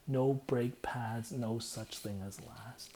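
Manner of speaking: slow, deliberate lyric; slow recital. Retold or imitated. Repeating the lyric, slow and deliberate. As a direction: savor each word slowly.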